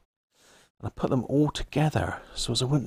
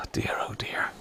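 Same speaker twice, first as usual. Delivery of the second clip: breathy, softer sigh of dismay; whispered dismay; 6 dB quieter than usual. Real, not acted. Man fed up with his radio setup, whispered dismay. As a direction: let it out under your breath.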